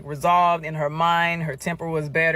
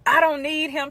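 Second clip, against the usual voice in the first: upset friend; 9 semitones higher than usual. Retold or imitated. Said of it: "She starts saying".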